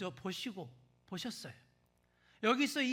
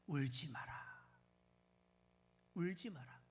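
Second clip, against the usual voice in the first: tender comfort; 3 semitones lower, 6 dB quieter than usual. Acted. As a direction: hush a weeping woman kindly. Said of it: "예수님이 이렇게 말씀하십니다".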